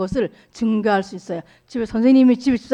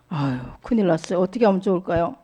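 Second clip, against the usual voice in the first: ordinary talk, nothing marked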